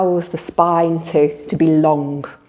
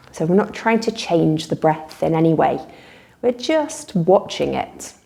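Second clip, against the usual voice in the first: calm guided relaxation with long pauses; guided meditation; 3 dB quieter than usual. Real, not acted. Teacher leading listeners in a body-awareness relaxation, unhurried meditation voice. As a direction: guide them gently through noticing breath and body.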